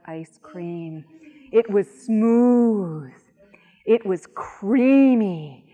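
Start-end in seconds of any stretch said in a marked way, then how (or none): 1.5-5.6 slow, drawn-out relish; slow savoring. A narrator's reading. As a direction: savor each word slowly. Savoring the ice cream.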